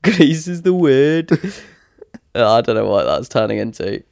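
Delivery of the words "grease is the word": high, slow hammed-up Brummie; hammy Brummie singing line; Brummy accent. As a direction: stretch it out in mock Birmingham. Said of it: "Brummy Grease".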